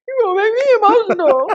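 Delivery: high, loud, near-tears cry; choking up as the words come out; tearing up. Retold or imitated. Retold guest praise, answering through tears, high and loud. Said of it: "it's making me emotional".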